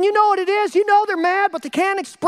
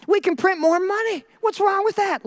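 higher, mock-innocent voice; breezy naive advocate; 5 semitones higher than usual. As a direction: wave the problem away lightly. Voices the lenient political left.

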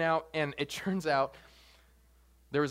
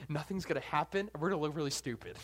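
ordinary delivery

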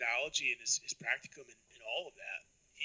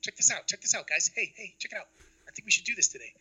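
eager, raised voice pitching an idea; excited coworker; 6 semitones higher than usual. Retold or imitated.

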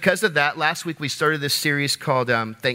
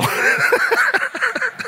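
high laugh. Real, not acted. Laughs at own punchline.

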